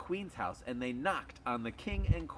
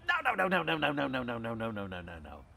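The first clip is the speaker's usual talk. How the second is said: cascade of denials, falling away; rapid-fire denial; 4 dB louder, faster than usual. Acted. Dogs denying they bite children, hasty denial.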